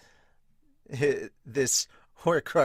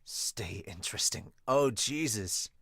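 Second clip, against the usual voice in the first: ordinary delivery, nothing marked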